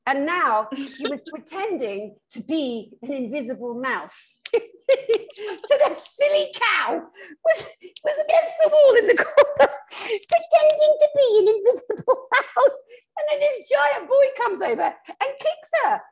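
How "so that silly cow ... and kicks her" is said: laughing. Real, not acted. Laughing through the funny part.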